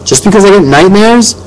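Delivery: high, offended question; indignant. A narrator's reading. Boy challenging both parents over his nightmares, indignant. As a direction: challenge them with rising pitch.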